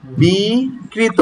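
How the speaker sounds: বিকৃত split as a long "bee", then "krito"; correct pronunciation. Shown as a demonstration. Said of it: "উচ্চারণ হবে".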